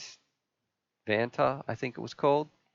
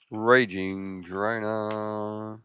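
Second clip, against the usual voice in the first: very slow, drawn-out words while typing; absent-minded typing; much slower than usual. Real, not acted. Streamer typing a drone name into search, absent-minded drawl. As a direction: stretch each word out as you type it.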